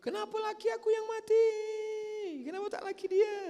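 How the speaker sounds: a high, keening wail of a widow, mocked. Preacher shows what forbidden wailing over a dead husband sounds like, in a high mock wail. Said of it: "Meratap itu macam mana?".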